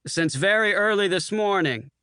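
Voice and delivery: a cocky pilot colonel dismissing the waiter; gruff colonel. A narrator's reading. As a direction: wave the waiter away. Bull retorts.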